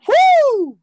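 very high, loud "woo" whoop; head voice. Shown as a demonstration. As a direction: send a ringing, high hoot up into the head. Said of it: "you feel it in your head".

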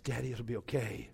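whispered imitation of a consoling daughter; hushed comforting. Daughter hugs her weeping dad, hushed reassurance. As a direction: soothe him very softly, under your breath.

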